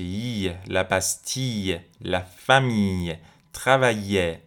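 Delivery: "لبستی، لفمی، ترویه" with a lingering Y glide, no L; doubled Y sound. Shown as a demonstration. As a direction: slowly stretch the Y in "لفمی" and "ترویه".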